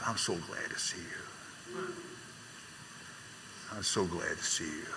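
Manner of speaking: put-on soft, slow, fragile old father's voice; frail father. Elderly father greeting his son, soft and slow.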